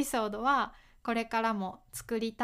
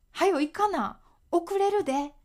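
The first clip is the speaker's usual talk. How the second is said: urging someone to hurry, higher and louder; impatient hurry-up; 6 semitones higher, 5 dB louder than usual. Shown as a demonstration. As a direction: nag them to come quickly. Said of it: "怖く聞こえるかな？".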